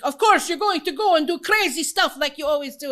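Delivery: hammed-up Russian accent for her dad. Imitating her dad teasing her about crazy stuff, loud mock Russian accent. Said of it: "does that sound Russian or not?".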